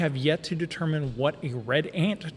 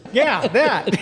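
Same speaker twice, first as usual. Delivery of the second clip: excited shout; 9 semitones higher, 7 dB louder than usual. Real, not acted. Recognizing the cow killers.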